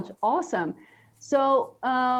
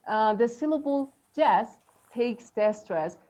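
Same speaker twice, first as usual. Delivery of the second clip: ordinary talk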